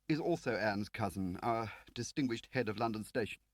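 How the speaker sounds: a prim Whitehall man; fussy official Lakin. Acted.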